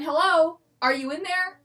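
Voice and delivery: calling down. Acted. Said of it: "I yelled down to it again".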